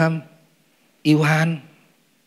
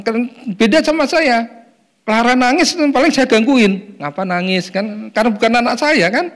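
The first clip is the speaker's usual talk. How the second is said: higher, louder, lively storytelling; animated; 8 semitones higher, 4 dB louder than usual. Real, not acted. Preacher animatedly compares himself to a child's father.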